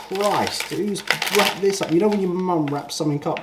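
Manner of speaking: high, exasperated complaint; irritated high outburst at tight wrapping. Real, not acted.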